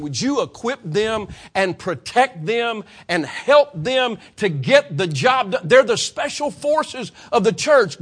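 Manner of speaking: loud, high, slow impassioned plea; full-force preaching. Acted. Preacher praying for missionaries, loud impassioned preaching. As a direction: build the prayer into a roaring climax.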